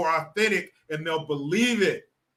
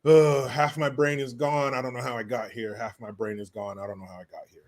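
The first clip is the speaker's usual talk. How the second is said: low muttered warm-up, fading right down; getting into character; 7 semitones lower than usual. Pretend. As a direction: chant it under your breath, trailing away. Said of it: "Let me get into character".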